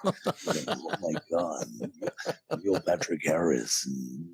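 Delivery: deep epic trailer narrator; low, booming trailer announcer; movie trailer voice. Shown as a demonstration. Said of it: "the epic movie trailer voice".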